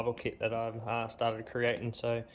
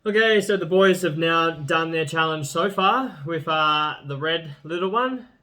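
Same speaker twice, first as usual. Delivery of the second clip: presenter voice; 5 semitones higher, 11 dB louder than usual. Acted. Hosts the chilli challenge.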